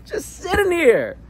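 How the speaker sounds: high, slowing mock wonder. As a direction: marvel at the chips, way up high.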